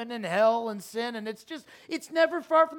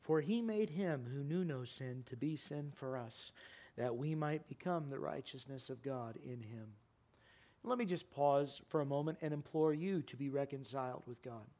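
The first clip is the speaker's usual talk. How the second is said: much lower, much softer closing appeal; quiet appeal; 13 semitones lower, 13 dB quieter than usual. Acted. Pastor softly urging the church to share faith, hushed appeal. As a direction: draw them in gently, pleading.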